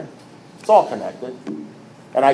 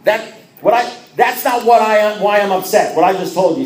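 high, loud, sputtering disbelief; indignant outburst; 8 semitones higher, 6 dB louder than usual. Retold or imitated. Member protesting to his unmoved sponsor, indignant. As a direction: protest in a raised, exasperated voice.

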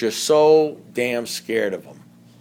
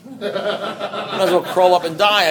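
higher, louder, slow and laughing; slow, louder, laughing repeat; laughing emphasis; 5 semitones higher, 4 dB louder, slower than usual. Real, not acted. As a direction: repeat it again through a laugh, bigger.

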